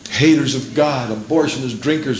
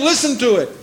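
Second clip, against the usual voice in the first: usual speaking voice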